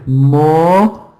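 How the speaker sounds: "Mortuary" split into long separate syllables. Shown as a demonstration. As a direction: draw out "Mortuary" piece by piece, pausing between. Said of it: "in the second syllable is the schwa".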